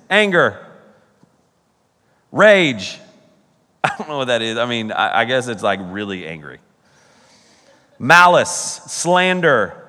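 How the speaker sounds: ordinary delivery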